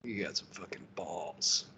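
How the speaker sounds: hoarse approving whisper; gruff whisper. Retold or imitated. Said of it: "And Lehman Russ is like".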